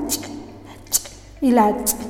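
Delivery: ch cut off with a glottal catch; glottalized ch. Shown as a demonstration. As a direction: click the ch shut in the throat. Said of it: "compuestas glotalizadas".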